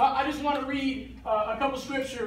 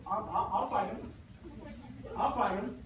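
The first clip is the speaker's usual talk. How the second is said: quiet volunteering; 3 semitones lower, 8 dB quieter than usual. Acted. David quietly volunteers to fight.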